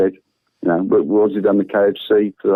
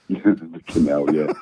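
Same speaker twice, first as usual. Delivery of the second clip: laughing; 6 semitones lower, 6 dB quieter, much slower than usual. Real, not acted. Laughing at the canal suggestion.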